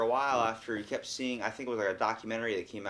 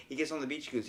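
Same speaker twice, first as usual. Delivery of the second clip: ordinary talk, nothing marked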